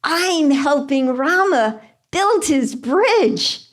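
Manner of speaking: high-pitched little chipmunk voice. Acted. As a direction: pipe up as a tiny, earnest helper. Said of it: "And the chipmunk said".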